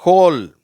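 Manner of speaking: ஹோல் high and loud, h sounded; ringing ஹோல் with a clear h; correct H pronunciation. Shown as a demonstration. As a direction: model HOLE after the mistake. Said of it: "இது ஹோல்னு சொல்லணும்".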